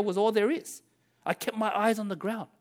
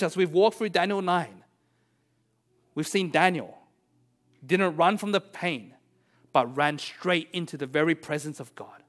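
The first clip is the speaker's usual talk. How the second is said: usual speaking voice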